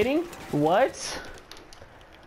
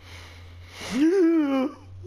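heavy sigh; 13 semitones higher than usual. Real, not acted. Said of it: "I don't know why I'm breathing so hard".

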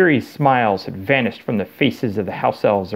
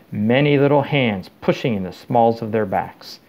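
ordinary delivery, nothing marked

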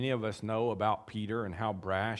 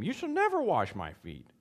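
higher, louder flat refusal; Peter refusing; 8 semitones higher, 5 dB louder than usual. Acted. Preacher voicing Peter's firm refusal to Jesus. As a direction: Peter digging his heels in.

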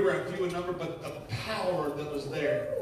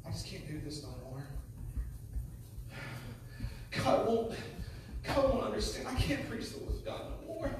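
much softer, broken despair; despairing, fearful; 3 semitones higher, 7 dB quieter than usual. Acted. Preacher acting out his fear before the church, despairing. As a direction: play a preacher who has given up.

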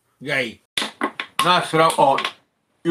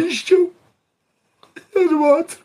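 mock wail; 12 semitones higher than usual. Mock martyr tale.

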